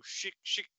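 whispered zapping shots; breathy imitated laser fire; laser noises. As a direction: mouth sound effect of blaster shots. Laser shot sound effect.

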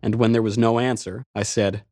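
ordinary delivery, nothing marked

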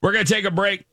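big upbeat radio host tease; radio contest promo. Acted. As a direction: pitch up high, sell the break. Radio tease before break.

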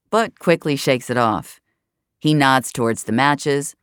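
ordinary delivery, nothing marked

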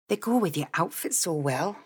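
soft, understated male character voice; gentle teasing, well under normal volume; grinning tease. A narrator's reading. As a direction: say it quietly through a grin. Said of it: "he continued with a grin".

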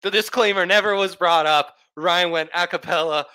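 excited announcement. Real, not acted. Mock announcement of show history.

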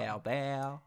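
a light French "Herbert" with a silent H; French accent. Shown as a demonstration. Cohost repeating the name in a French accent. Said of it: "that was a nice little accent you had there".

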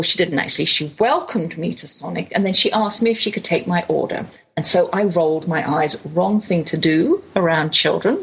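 normal talking voice

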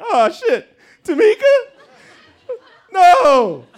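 high, loud, hammed-up grief; badly faked anguish in an audition; fake crying. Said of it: "I still had to fake cry".

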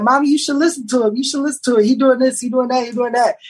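lightly voiced daughter urging her mother to trust him; daughter pleading. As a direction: play the daughter coaxing her mom. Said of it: "she was like".

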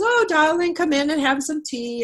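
lightly voicing an old lady offering tea; sweet old lady. Elderly client greets narrator warmly.

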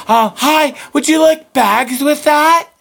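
high-pitched robotic store greeting; mock robot cashier. Robot cashier asking a shopper about bags, mocking high voice. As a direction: parrot it high like a mindless cashier. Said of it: "they're like fucking robots".

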